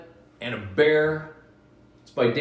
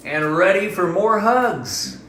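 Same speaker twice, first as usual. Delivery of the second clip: high, louder, slow cuddly bear line; happy bear; 9 semitones higher, 7 dB louder than usual. Acted. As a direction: promise hugs like a playful bear. Bear's happy hug line.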